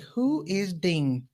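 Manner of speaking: slow, drawn-out mock-mother question; imitating a mother demanding a name; suspicious mother. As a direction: stretch each word with slow suspicion. Suspicious mother interrogates son.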